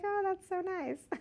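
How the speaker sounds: high, soft mock compliment; imitated admiring woman.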